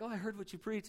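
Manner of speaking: breezy and harmless, as if it's a compliment; mock friendly greeting, nothing behind it; light friendly version. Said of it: "It wasn't like".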